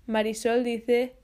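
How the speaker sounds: ordinary talk, nothing marked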